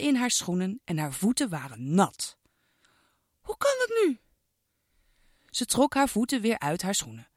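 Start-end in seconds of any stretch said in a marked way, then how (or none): 3.4-4.2 put-on high, childlike girl's voice, puzzled; a little girl finding wet shoes; girl Iris puzzled. Girl finds her shoes are wet, high puzzled child voice.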